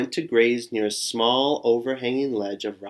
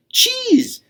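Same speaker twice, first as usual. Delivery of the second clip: high, loud enticing cheese; tempting cheese; 7 dB louder than usual. Acted. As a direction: dangle the word like a treat. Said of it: "says Borreguita".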